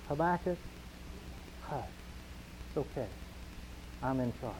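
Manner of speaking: soft, tender reassuring hush; calm him softly; gentle comfort. Retold or imitated. God soothing Habakkuk, soft reassuring hush. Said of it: "what God did was say".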